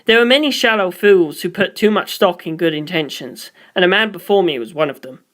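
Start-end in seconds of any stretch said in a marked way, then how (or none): none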